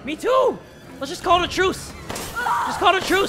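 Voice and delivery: high sarcastic mock agreement. Real, not acted. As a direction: answer the boss in sarcastic falsetto. Mocking reply to boss.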